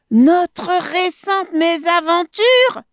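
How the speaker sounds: high, loud incredulous repeat; indignant echo. A narrator's reading. Monkey repeats the crocodile's excuse, indignant. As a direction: throw the words back high and outraged.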